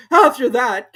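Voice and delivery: high, loud start; high, loud Hatter; Hatter telling. A narrator's reading. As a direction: carry on nervously. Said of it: "continued the Hatter".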